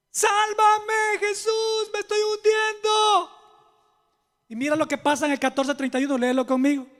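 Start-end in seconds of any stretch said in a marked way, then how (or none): 0.1-3.3 high, pleading cry; desperate cry for help. Acted. Pedro, sinking in the storm, cries to Jesus for help. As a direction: scream for rescue while sinking. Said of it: "comienza a gritar".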